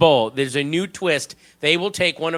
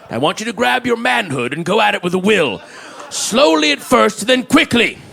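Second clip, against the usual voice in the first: Daniel Plainview voice; 6 semitones higher than usual. Acted. Phone sex operator in Plainview's intense voice. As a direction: sell it like a menacing oilman seducing a caller. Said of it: "that fucking voice all day".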